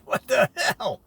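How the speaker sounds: rising to a shout at the end; shocked exclamation. Retold or imitated. Veterans back home, yelling in disbelief at the small town. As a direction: blurt it out, then holler the last line. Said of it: "and they go".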